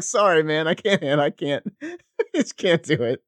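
can't stop laughing while saying sorry; laughing apology. Retold or imitated. To his friend after the blowup, laughing apologetically.

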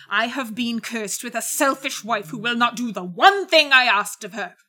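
loud, building bitter rage; angry outburst. A narrator's reading. Betrayed husband condemning his wife, angry outburst.

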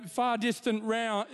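ordinary delivery

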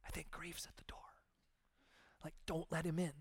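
hushed, secretive whisper; embarrassed whisper. Pretend. Embarrassed churchgoer whispering that grief is at the door. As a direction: whisper it so grief can't hear. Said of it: "We're like".

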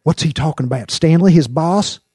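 high, loud nervous question. A narrator's reading. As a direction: ask your partner anxiously what's going on. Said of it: "asked Tram nervously".

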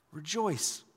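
ordinary talk, nothing marked

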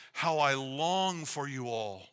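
slow "how I long for you all", "long" and "all" drawn out; stress on all. Shown as a demonstration. Pastor reading Paul's longing for the church, stressing "all". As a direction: linger on "long", then settle on "all". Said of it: "emphasis on the word all".

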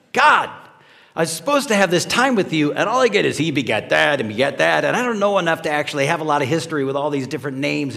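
fast, loud, frustrated whining at God; mock complaint to God. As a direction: rattle it off fast like a fed-up jogger. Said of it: "I'm getting mad".

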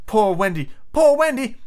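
high, plaintive mock moan; storybook bird's lament. A narrator's reading. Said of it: "it moans".

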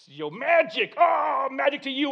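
mock-epic spell-casting, voice rising; movie wizard parody; hammed-up wizard duel. Acting out bombastic movie fight.

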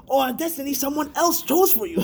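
high-pitched hammed-up narration; mock-epic imitation. Co-host joining in the parody of the intro, mock-epic. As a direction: parody a grand voice-over, close to laughing.

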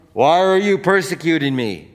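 high, very loud demanding shout; booming rebuke. Acted. Preacher as Jesus confronting Saul, booming loud rebuke. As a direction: hurl the question from above.

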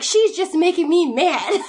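high, loud imitation of a cross toddler; indignant little child. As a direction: drag out a child's complaint, amused. Imitating an indignant three-year-old.

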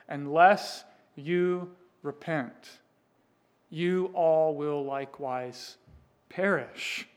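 slow, deliberate and stern; Jesus warning the crowd, slow and firm; solemn warning. Acted. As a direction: lay out each word like a verdict.

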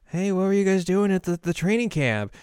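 high, loud, prying question; hammed-up jealous interrogation; mock jealousy. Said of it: "be very jealous and be like".

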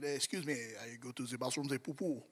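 lightly put-on French accent, low and soft; mock-fancy French bathroom request. Pastor retelling a comedian's French bathroom joke, light French accent. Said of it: "you can talk about going to the bathroom in French".

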